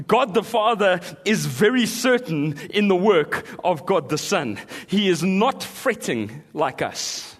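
loud, high preaching; full-force preaching. Acted. Preacher booms the psalm to the congregation, full force. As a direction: preach it out to the crowd.

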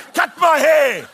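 shouted, high and fed up; frustrated shout. Retold or imitated. Exasperated customer yelling at a chatty barber, loud. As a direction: snap at the barber to just get on with it.

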